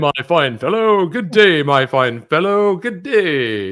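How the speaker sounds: loud, high, grandly overdone hello; hammed-up greeting. Host greets co-host as fine fellow, hammed-up and loud. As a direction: play a hearty gent, loud and high.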